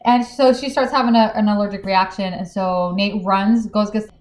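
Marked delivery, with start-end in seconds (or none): none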